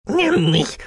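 hoch, quengelnd, verzerrt; quengelnde Kanne. Acted. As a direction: wie ein bettelnder alter Kessel. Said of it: "quengelte sie". (quengelnde, verzerrte Maschinenstimme)

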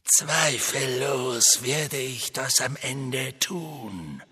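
slow icy villain; cold voice. A narrator's reading. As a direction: drawl it out icily. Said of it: "sagte die kalte Stimme".

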